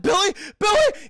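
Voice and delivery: high, loud, urgent shout; mock calling out. Streamer as dad calling for Billy, mock urgent shouting. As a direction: holler for your missing son.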